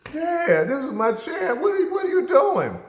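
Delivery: put-on high, loud boyish protest; indignant kid shout. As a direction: shout it up high like an outraged boy. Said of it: "I'm sitting there like".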